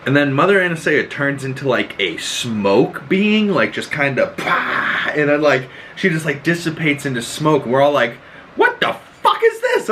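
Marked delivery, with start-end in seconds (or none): 4.4-5.1 breathy burst noise, "pah"; imitated poof. Imitates smoke bursting apart. 8.5-9.9 high squeaky burst of astonishment; shocked disbelief. Retold or imitated. Acts out the viewers' shocked reaction.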